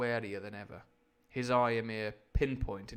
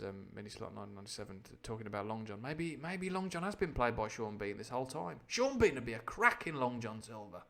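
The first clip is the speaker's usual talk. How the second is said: ordinary delivery, nothing marked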